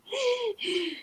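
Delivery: sharp startled gasp, out of breath; panting gasp. A narrator's reading. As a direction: gasp like a winded runner. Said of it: "he's, like, panting".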